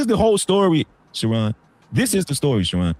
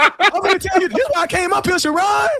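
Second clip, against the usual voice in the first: excited; 14 semitones higher than usual. Real, not acted. Hyped up to tell the story.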